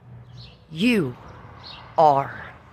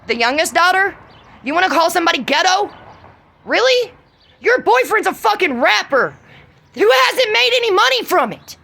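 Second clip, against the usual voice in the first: scornful jab; 8 semitones higher than usual. Real, not acted. Mocking the youngest daughter.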